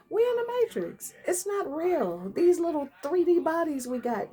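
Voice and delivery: high, animated preaching; light up with a giggle; excited. Real, not acted.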